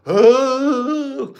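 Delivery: high, sustained mock "oh"; imitated long moan. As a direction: hold a high-pitched, exaggerated cry of "oh". Imitating the woman's reaction.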